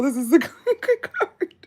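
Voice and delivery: giggling over a lucky draw; laughing. Real, not acted. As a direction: laugh with delight at the card. Laughs at pleasing card.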